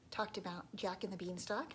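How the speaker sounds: ordinary delivery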